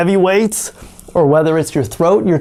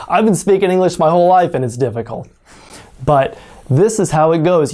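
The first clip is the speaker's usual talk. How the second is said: usual speaking voice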